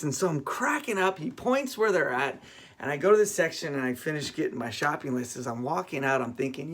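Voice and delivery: usual speaking voice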